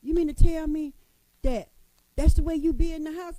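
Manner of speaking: loud, incredulous question; mock pastor friend, loudly astonished; shocked friend. Pastor friend questioning her about home life, loud disbelief. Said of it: "And she said".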